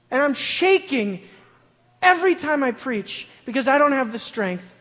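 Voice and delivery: high, loud, impassioned preacher; preaching at full force. Acted. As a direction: cry it out to the congregation. Peak of the sermon.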